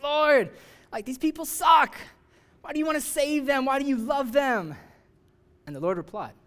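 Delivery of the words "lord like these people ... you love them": much higher, louder, whining complaint to God; a sulking prophet griping that God is too good; whiny angry Jonah. Acted. Jonah complains about Assyrians.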